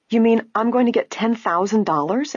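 high, astonished question; excited, incredulous rise in pitch; crying out. A narrator's reading. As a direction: cry out in disbelief. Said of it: "Allison cried out".

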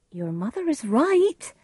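nurse. A narrator's reading. Nurse advises Juliet.

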